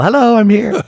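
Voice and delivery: high, singing-out mock greeting; lightly hammed-up newcomer's hello; cheery hotel arrival. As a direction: call out a pretend check-in. Jokey hotel check-in at the bell.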